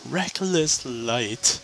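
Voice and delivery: slow, soft, drawn-out weapon name; hushed, slow, exaggerated announcement; mock-reverent naming. Real, not acted. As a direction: savour the name in mock awe. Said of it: "What a wonderful name".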